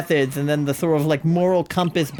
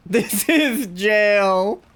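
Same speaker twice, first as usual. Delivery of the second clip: mock announcement; 6 semitones higher, much slower than usual. Joking bit, drawn out.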